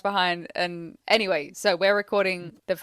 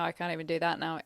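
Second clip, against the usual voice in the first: normal talking voice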